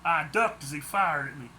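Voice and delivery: plain reading voice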